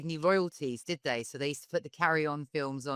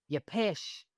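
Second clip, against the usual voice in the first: tentative attempt at a Scottish accent; 5 semitones higher, slower than usual. Shown as a demonstration. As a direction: have an amateur go at Scottish. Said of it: "like, the Scottish accent".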